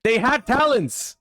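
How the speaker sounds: loud, high outburst of disbelief; excited shout. Real, not acted. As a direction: yell it out, shocked and thrilled. Excited shout at opponent's card.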